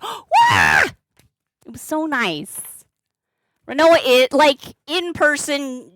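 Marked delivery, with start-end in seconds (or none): 0.0-0.8 high, loud startled "Wah!"; startled yelp. Retold or imitated. Streamer recalling being flagged down, high startled yelp. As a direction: squeal as if caught by surprise. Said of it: "I'm like".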